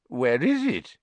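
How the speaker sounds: lightly voiced reedy official; a touch thin and puzzled. Committee member finding Buckbeak gone, reedy voice. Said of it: "said the reedy voice of the committee member".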